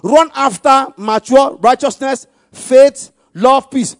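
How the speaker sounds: loud, high and slow proclamation; emphatic pulpit shout on each phrase; forceful scripture reading. Real, not acted. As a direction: drive the verse out to the congregation. Preacher reads scripture at full force.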